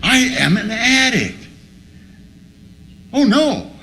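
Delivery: high, dismayed mock cry; horrified realization. Addict acting out reading the truth, horrified realization.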